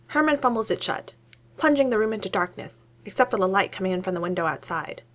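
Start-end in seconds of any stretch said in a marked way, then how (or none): none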